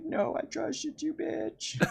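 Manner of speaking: high, slow, hammed-up wounded cry breaking into a laugh; mock betrayed hero. Peter rejecting the villain, mock heartbroken and laughing. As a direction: overdo the heartbreak until it cracks up. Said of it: "And you're like".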